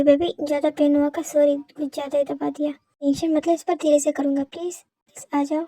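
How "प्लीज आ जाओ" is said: ordinary talk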